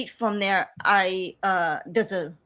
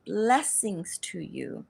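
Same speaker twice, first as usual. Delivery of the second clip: grandma blessing; 3 semitones lower, 6 dB quieter, much slower than usual. Retold or imitated. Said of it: "The grandma lady in front of me says".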